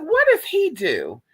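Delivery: high, slow, curious question; high nosy put-on voice; nosy curious neighbor. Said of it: "so I was like".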